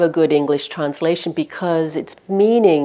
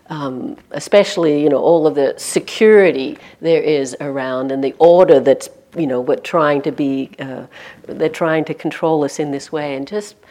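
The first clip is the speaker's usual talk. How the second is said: ordinary talk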